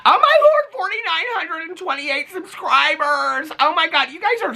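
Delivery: high, squealing, slow count of the number; overjoyed at the subscriber count; real excitement. Real, not acted. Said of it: "I'm so excited about this".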